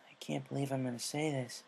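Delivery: a reluctant confession aside; whispered, embarrassed aside. Acted. Aside under her breath.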